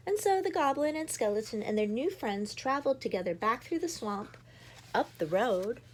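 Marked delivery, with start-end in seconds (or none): none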